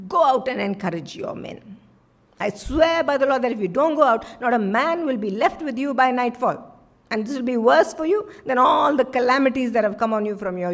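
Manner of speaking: loud, firm orders; stern command. Acted. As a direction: command the king, no softness. Joab orders the king.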